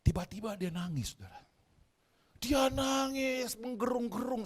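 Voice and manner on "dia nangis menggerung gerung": high, drawn-out mock wail on the words; dramatised telling. Preacher telling how the old patient bawled, dramatised telling. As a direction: stretch it out to imitate bawling.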